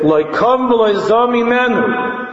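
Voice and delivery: high, slow proclamation of scripture; slow high-pitched recital; preaching declamation. Real, not acted. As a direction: draw the verse out high and ringing. Declaims the quoted verse.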